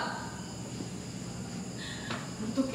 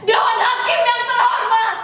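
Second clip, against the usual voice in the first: high, loud cry to the judges; full-force courtroom outcry; shouted address; 9 semitones higher, 7 dB louder than usual. Acted. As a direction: shout to make the court listen. Demands the judges' attention.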